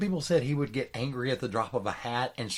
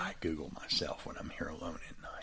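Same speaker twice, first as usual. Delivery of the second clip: low, hushed whisper; mock-secret whisper; 8 semitones lower, 12 dB quieter than usual. Joking to co-host about googling oneself, whispered confession.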